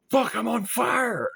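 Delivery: high, drawn-out panicked shout; panicked yell. Acted. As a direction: yell it out in sudden alarm. Panicked realisation in retold story.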